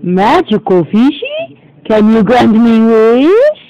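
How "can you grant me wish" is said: ordinary delivery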